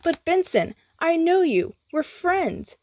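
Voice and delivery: high, earnest insistence; proclaimed. A narrator's reading. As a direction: plead your friendship. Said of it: "Skips proclaimed".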